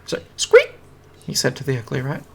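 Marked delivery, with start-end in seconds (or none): 0.0-0.7 very high, loud squeaky apology; loud rat squeak. Acted.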